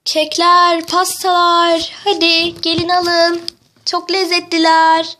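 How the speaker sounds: slow, drawn-out hawking cry; shouted vendor's call. Acted. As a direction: call out to passing customers, stretching words. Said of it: "Sen bağırma".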